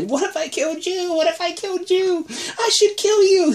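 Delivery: put-on very high, creepy, slowing child voice; really high insane voice. Lizzie threatening Carol, really high and crazy. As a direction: threaten her sweetly and madly. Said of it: "and really high".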